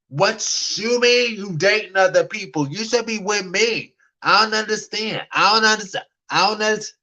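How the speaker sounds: high, hammed-up whiny voice, repeating in disbelief; ridiculous whiny protest. Mocking her younger self protesting to the guy who dates others, high and whiny. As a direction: play your past self as a clingy, baffled pout. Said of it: "I was kind of a little bit, um, ridiculous".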